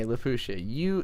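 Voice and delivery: ordinary talk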